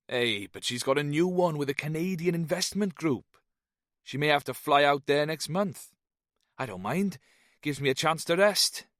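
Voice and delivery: relaxed Welsh ramble; lilting Welsh accent. A narrator's reading. Jones chats about his wife in a lilting Welsh voice. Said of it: "Jones's diction was lilting and slow".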